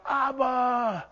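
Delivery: high pleading "Abba" fading to a soft plea; Jesus pleading in the garden; crying out. Retold or imitated. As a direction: cry out to the father, then let it sink. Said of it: "you see Jesus crying out".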